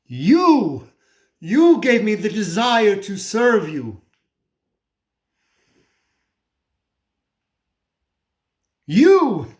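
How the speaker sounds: anguished accusation. Acted. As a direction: cry out "you" loud and high, then press on. Accuses God in anguished monologue.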